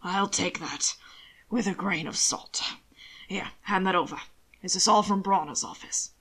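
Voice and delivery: understated, slightly husky gentleman's order; lightly voiced dry master. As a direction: give dry, low-key instructions. Roland takes the papers.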